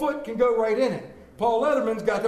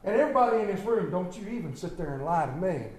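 ordinary talk, nothing marked

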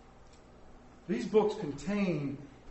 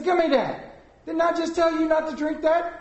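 high-pitched, louder retold scolding; exasperated parent; 9 semitones higher, 4 dB louder than usual. Dad to child who took his Coke, exasperated.

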